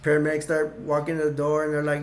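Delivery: ordinary talk, nothing marked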